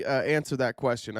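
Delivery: normal talking voice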